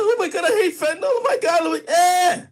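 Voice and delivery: high, loud mock wail of despair; mocking dramatic reaction. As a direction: ham up the fake grief. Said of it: "y'all was like".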